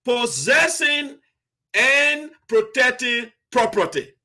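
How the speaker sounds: possessing and protecting property drawn out slowly; slow, weighted key phrase; slow emphasis. Shown as a demonstration. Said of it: "key word over there".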